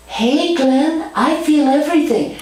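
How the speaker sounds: put-on a child joining the game; lightly voiced child. Child playing along.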